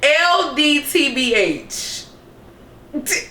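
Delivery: letters spelled out slowly; slow spelling. Shown as a demonstration. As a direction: space each letter out. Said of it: "spells it out".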